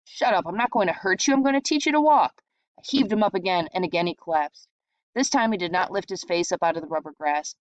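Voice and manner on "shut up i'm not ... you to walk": exasperated shout. A narrator's reading. Brother angry, insisting.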